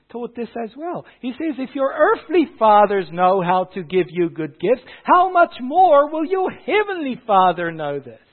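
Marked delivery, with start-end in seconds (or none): 1.6-8.2 loud, climbing high to a peak; impassioned preaching. Retold or imitated. Preacher quoting Jesus on heavenly Father, loud rising exhortation. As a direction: build to a high, forceful rhetorical question.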